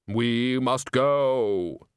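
slow, dry Death voice; bass voice. Acted. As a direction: speak as unhurried Death. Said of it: "you do have a very good bass voice".

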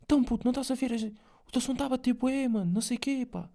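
high, quick hyped-up manager voice; excited manager. Retold or imitated. Manager calling the artist, voiced high and fast with hype. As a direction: hype him up like a buzzing manager. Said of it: "liga ao manager para ele".

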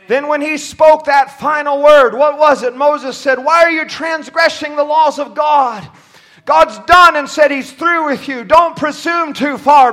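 stern sermon delivery; full-force preaching. Acted. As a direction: warn the congregation with weight. Preaches a warning.

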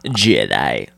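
deep, sinister impersonation; Sidious impression. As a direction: drop low for a mock Star Wars emperor. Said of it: "Can you do the Sidious voice?".

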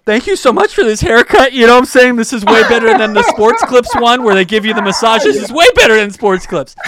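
high, loud, hammed-up crying voice; mock tearful gushing. Tearful customer thanking his hairdresser mid-haircut, mock crying. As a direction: blubber your thanks in a comic wail. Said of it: "I'm starting to cry while I'm getting my hair cut".

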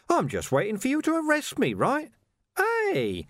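high, cheeky sneering villain; cocky high-pitched taunting; Carcer's voice. A narrator's reading. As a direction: taunt him, grinning, in a high voice.

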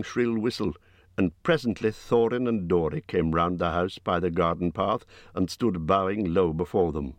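ordinary delivery

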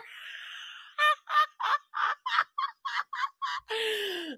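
sustained high giggling; long high laugh. Real, not acted. Podcaster still laughing at the Underlord, long high laugh. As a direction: keep laughing.

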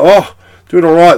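loud, high put-on approval; fake enthusiasm. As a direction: bluff that you follow the score. Feigning cricket interest to granddad.

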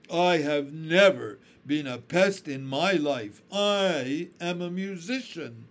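a musician insulted, correcting the upstart; scornful old grasshopper. A narrator's reading. Old grasshopper insists to the centipede, proud and scornful. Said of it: "speaking in a deep, slow, scornful voice".

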